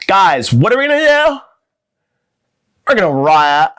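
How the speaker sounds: camp caricature rallying friends. Acted.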